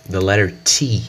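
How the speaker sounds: ordinary talk, nothing marked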